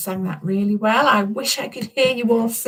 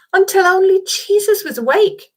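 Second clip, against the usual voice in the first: ordinary talk, nothing marked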